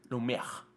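Lumière said the French way, soft; Lumière with French vowels; French pronunciation. Shown as a demonstration. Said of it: "nótese el acento francés".

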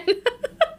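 a high burst of real giggling; high giggle. Real, not acted. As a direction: let a giggle escape. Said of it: "And I'm laughing".

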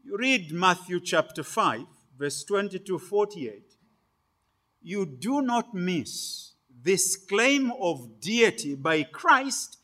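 ordinary delivery

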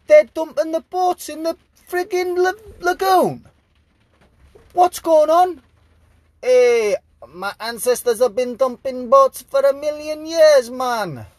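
mock Italian accent. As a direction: play a Venetian sailor in a jokey Italian accent. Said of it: "what kind of an accent was that".

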